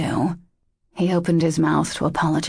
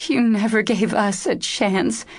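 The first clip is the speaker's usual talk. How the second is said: higher-pitched, forlorn reproach; achingly sad, forlorn; 5 semitones higher than usual. A narrator's reading. Injured woman answers Dash's declaration of love, achingly sad. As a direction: reproach him, aching with sadness. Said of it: "in an achingly sad, forlorn".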